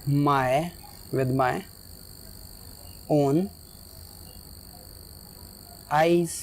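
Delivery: each word set apart with long pauses; slow dictation. Shown as a demonstration. Teacher dictating to students, slow word by word.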